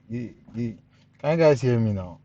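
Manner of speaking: normal talking voice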